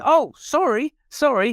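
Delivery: high, quick, startled apology; a caught-out guest backing off at once; flustered apology. Retold or imitated. Guest caught grabbing the curtain, high flustered sorry. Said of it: "And he goes".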